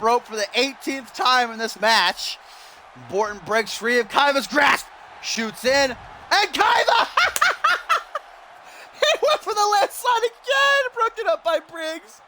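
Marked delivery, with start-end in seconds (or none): 6.3-12.2 very high hysterical commentary shriek; giddy ringside commentator losing it; excited screaming laugh. Real, not acted. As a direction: shriek the call through laughter. Commentator erupts at attempted landslide.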